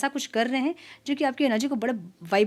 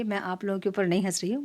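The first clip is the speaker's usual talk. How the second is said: usual speaking voice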